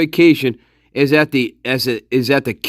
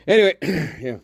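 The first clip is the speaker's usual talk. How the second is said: ordinary talk, nothing marked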